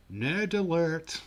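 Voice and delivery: loud, high, drawn-out mock alert; mock alarm call. Announcing the cashier's nerdy tattoo, mock alarm call. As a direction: call it out like a playful siren.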